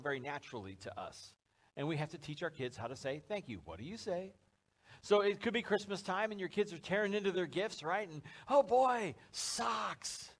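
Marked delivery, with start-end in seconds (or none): 3.6-4.3 soft, lilting "what do you say?"; sing-song prompt. Acted. Parent teaching kids to say thank you, sing-song. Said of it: "little sing-song almost". 8.5-10.3 high-pitched mock delight over a gift; excited kid. Kid tearing into Christmas gifts, excited.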